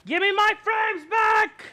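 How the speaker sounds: high, loud, drawn-out furious yell; angry shout. Real, not acted. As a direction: scream it at the thief who took your frames. Yelling at thief in game.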